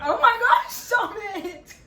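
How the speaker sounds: high, loud shocked squeal. Real, not acted. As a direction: squeal in total disbelief. Surprise proposal, she gasps.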